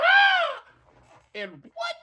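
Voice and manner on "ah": very high, louder frightened scream; high scared scream. Retold or imitated. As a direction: shriek as you jump back. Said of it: "I jump".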